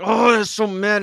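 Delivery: high, loud mock outrage; hammed-up angry outburst; imitated anger. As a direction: act out someone getting furious. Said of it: "he's so mad".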